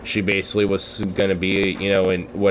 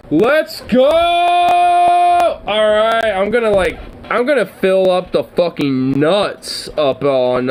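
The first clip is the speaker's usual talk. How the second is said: high excited shout, much higher and louder; excited yell; 10 semitones higher, 4 dB louder, much slower than usual. Real, not acted. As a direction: cheer it out like a hyped fan. Excited about squirrel day.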